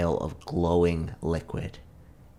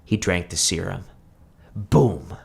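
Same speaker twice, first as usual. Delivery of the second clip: plain reading voice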